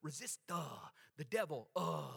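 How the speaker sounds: preacher mocking a hesitant churchy rebuke of the devil. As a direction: fumble it like a timid believer. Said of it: "They say it with all that churchy voice".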